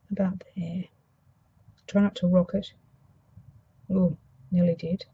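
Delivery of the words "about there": whispering. Real, not acted. Placing the sentiment carefully.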